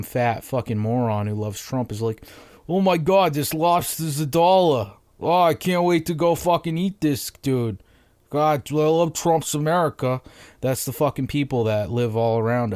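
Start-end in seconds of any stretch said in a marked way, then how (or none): 2.7-10.2 mocking Massachusetts townie accent, higher and louder; loud Massachusetts accent, gleeful and dumb; towny Massachusetts dude. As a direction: ham up a Boston bro gushing over cheap seafood. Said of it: "like, a towny voice, like, uh, Massachusetts fucking dude".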